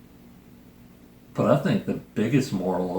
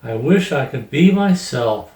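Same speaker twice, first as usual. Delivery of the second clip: lightly voiced pleading chameleon, louder, a touch higher; 4 semitones higher, 9 dB louder than usual. The chameleon's final wish in the picture book, lightly voiced, louder plea. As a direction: make the wish out loud like the chameleon.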